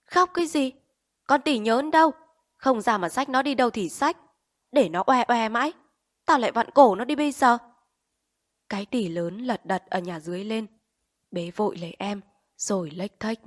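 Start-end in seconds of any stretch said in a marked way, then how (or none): 0.1-7.6 much higher, louder shout; yelling mother. A narrator's reading. Mother yells at her crying children, furious. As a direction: bark at the crying kids. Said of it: "thì mẹ nó đã quát".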